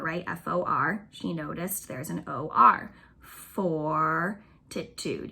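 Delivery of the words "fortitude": Fortitude drawn out slowly to show the or; slow teaching demo of "Fortitude"; stretched out slowly. Shown as a demonstration. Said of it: "You can hear the or".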